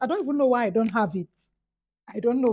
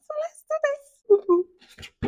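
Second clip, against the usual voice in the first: squeaky playful; 4 dB louder, much slower than usual. Real, not acted.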